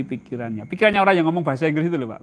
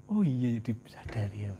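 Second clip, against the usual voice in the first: hushed whispered realization; 4 semitones lower, 8 dB quieter than usual. Acted. Preacher whispering the listener's realization, hushed. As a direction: whisper it as a dawning thought.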